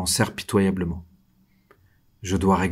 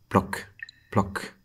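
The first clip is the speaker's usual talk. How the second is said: soft, fading drip sounds, each one quieter; dripping water; 4 dB quieter than usual. Acted. Prisoner hearing water drip in his cell, soft fading imitation. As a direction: mimic water drops falling in the dark.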